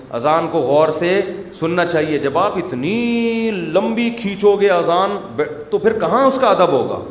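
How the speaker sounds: ordinary delivery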